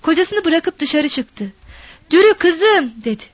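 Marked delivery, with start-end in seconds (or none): 2.1-2.9 high, raised searching call; mother's high-pitched call. A narrator's reading. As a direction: call anxiously for a missing daughter. Mother calling for missing daughter.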